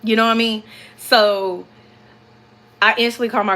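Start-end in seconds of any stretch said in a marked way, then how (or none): none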